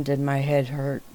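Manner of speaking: usual speaking voice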